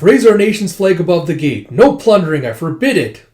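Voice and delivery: loud, high acted command; shouted orders. Commander forbidding troops to plunder, shouted command. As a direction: order the troops firmly and loudly.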